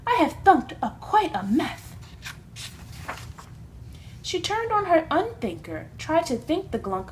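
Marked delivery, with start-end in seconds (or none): 0.1-1.8 gasped. A narrator's reading. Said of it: "gasped my sister".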